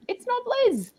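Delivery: very high playful squeak on it's not Liz; high comic echo; squeaky high repeat. Shown as a demonstration. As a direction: pipe it up high, teasing.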